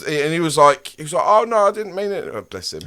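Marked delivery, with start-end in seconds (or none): 1.3-2.2 meek backing-down bloke. Retold or imitated.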